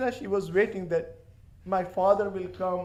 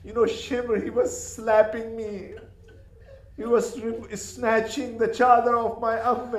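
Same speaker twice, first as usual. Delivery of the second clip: put-on child complaining; 7 semitones higher than usual. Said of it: "she started complaining".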